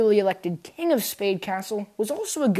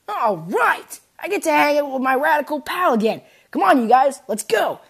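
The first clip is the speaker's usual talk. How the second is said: excited; 7 semitones higher, 6 dB louder than usual. Acted. Susie thrilled to see Lancer.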